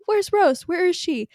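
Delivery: mock frantic shout for a missing friend; retold yell. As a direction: shout it like he's lost her. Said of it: "you yelled and was like".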